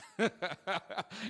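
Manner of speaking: a bright, high burst of laughter; real laugh. Real, not acted. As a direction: laugh at yourself, loud and high. Self-deprecating aside in sermon.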